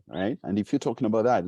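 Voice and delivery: ordinary delivery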